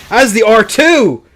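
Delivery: high, loud, stretched call; excited commentator shout. Real, not acted. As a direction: shout the big hit as it lands. Live call of a big hit.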